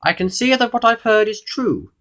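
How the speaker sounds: high, loud regal judgement; goblin king. A narrator's reading. King judging the indignant visitor, high regal voice. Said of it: "said the king".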